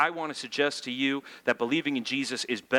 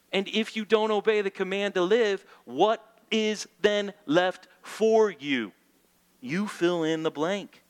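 preaching; 6 semitones higher than usual. Acted. Preacher rising in intensity.

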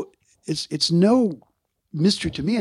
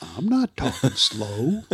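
slow protest; 3 semitones lower, 4 dB quieter, slower than usual. Retold or imitated. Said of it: "I go".

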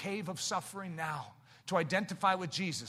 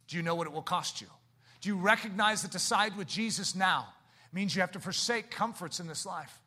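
ordinary talk, nothing marked

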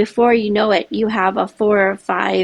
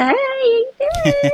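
much higher, sugary customer-service hello; fake-nice service voice. Cashier greeting a bratty customer, sugary and much higher. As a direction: smile it at a rude customer.

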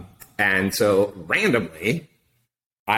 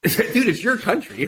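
laughing disbelief; 11 semitones higher than usual. Retold or imitated. Laughing at the question.

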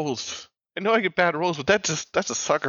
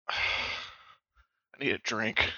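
low, soft, worn-out mumble; soft, lower-pitched weariness; drained and deflated; 5 semitones lower, 9 dB quieter than usual. Acted. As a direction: slump into the words, exhausted. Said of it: "kinda flops in her chair".

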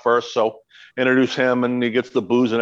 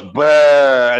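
loud drawn-out "bah"; imitating a wrestler's scare sound; imitated scare noise; 8 dB louder than usual. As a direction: blurt a loud mock monster noise. Imitates wrestler scaring the drunk woman.

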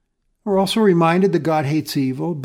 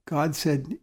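ordinary talk

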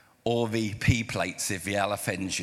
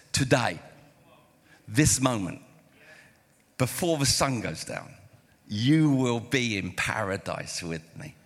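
solemn promise. Acted. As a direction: give each phrase its own breath, gravely. Jesus promises the thief paradise.

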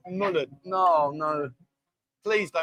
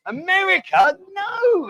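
high, loud disbelieving outburst; incredulous; 10 semitones higher, 5 dB louder, slower than usual. Real, not acted. Host rejecting a wrong quiz answer, incredulous. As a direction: blurt it out in high-pitched disbelief.

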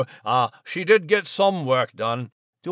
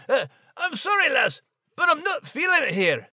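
much higher Scots apology; Scottish Max; 11 semitones higher than usual. Acted. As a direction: shrug it off in a Scottish brogue.

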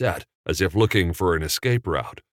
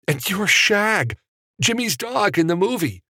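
high, delighted blurting; excited recognition; 14 semitones higher than usual. A narrator's reading. Evan recognising Jimmy's dog from the movie, excited. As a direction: blurt it high like a starstruck fan.

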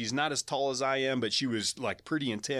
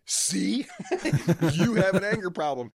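higher, louder, laughing through it; amused I-told-you-so laugh; 6 semitones higher, 5 dB louder than usual. Retold or imitated. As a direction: laugh it off at him. Holly laughing at his outburst.

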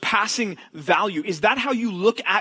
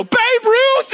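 very high, loud astonished yell; shocked shout; 10 dB louder than usual. Retold or imitated. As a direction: scream the name in disbelief. Kids freak out.

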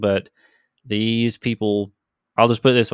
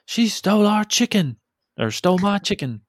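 high mock yell of the player's cry; imitating the shout; 10 semitones higher than usual. GM replaying Coin's cry heard from inside, a high mock yell. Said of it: "you can hear him say".

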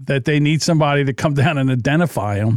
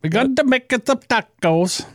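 put-on higher voice, light Spanish accent on "tacos"; hammed-up accent; 10 semitones higher than usual. Co-host joins mocking accented "tacos", higher pitched. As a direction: play along mocking the accented word. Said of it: "accent for one word".

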